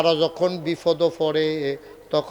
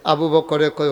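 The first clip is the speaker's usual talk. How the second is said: ordinary talk, nothing marked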